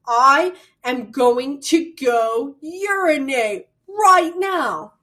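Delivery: slow, loud and rising, hammed-up bluntness; puffed-up declaration in a power stance; overly blunt announcement. Shown as a demonstration. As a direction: announce it like a proud decree, over the top. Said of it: "taking a power stance like this".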